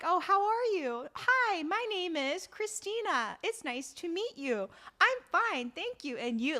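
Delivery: high, singsong textbook greeting; English-class dialogue. Acted. Acting out English small talk with kids, high textbook recital. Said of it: "when you talk to kids".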